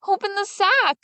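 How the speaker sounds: high, loud call from inside the sack; Little Claus calling to the drover from the sack, loud and high; shouted. A narrator's reading. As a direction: shout it out to be heard through the sack. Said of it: "Little Claus shouted".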